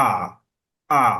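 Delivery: "আ" pressed tight from the middle of the throat; short, pressed throat "আ"; hard throaty a. Shown as a demonstration. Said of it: "সেই আটা হবে শক্তভাবে".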